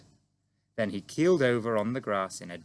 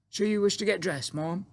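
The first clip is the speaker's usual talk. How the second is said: higher maid's voice; timid maid; 10 semitones higher than usual. A narrator's reading. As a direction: ask it politely, a little nervous.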